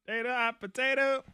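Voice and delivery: loud high chanting yell; shouting match. Acted. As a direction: yell it like a game-show chant. Game chant, yelling.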